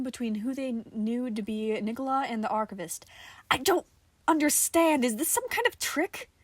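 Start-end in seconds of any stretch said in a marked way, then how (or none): 3.5-6.3 demanding. Acted. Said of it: "she demanded".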